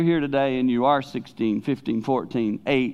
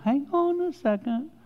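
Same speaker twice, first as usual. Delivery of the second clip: high-pitched playful halt; sudden high-voiced interruption, acted; acted interjection; 13 semitones higher than usual. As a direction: pipe up high to stop someone. God interrupts in retold dialogue.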